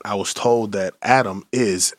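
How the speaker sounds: ordinary talk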